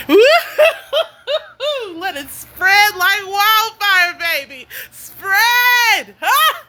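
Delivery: high, drawn-out celebratory whooping and cries; real excitement. Real, not acted. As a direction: whoop it out high and stretch the words. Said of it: "I'm so excited, y'all".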